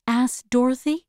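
ordinary delivery, nothing marked

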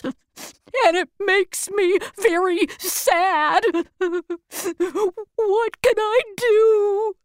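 slow, high and tearful; crying. Acted. The cowardly lion crying to his new friends, slow and tearful. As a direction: sob the question out slowly. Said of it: "and he started to cry".

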